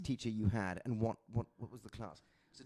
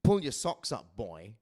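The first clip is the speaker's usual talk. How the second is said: stern teacher; 2 semitones lower, 7 dB louder, slower than usual. Retold or imitated. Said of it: "it's this kind of".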